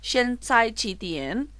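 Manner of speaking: Xianzai chi tian spoken slowly with tones; Mandarin phrase demo. Shown as a demonstration. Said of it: "cuando preguntábamos las horas". (frase en chino dicha despacio)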